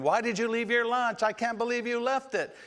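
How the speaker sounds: high, loud scolding yell; mock angry yell. Parent yelling at child over the forgotten lunch, mock furious yell. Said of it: "yelling at 'em and hollering".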